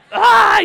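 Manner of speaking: sudden high, loud yelp imitating a child; retold kid scream. Pastor imitating his son's scream on the mat. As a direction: shriek it like a kid faking an injury. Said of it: "He's screaming".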